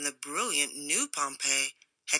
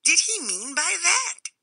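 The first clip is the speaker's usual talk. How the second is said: high, bewildered squeak; squeaky puzzled cameo cutter; 12 semitones higher than usual. A narrator's reading. Cameo cutter wonders aloud at the warning, high. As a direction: ask it baffled and squeaky. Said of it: "said the cameo cutter".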